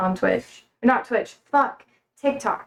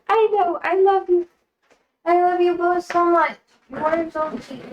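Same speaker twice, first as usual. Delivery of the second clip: cooing to pets; 6 semitones higher than usual. Real, not acted. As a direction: coo lovingly at your pets.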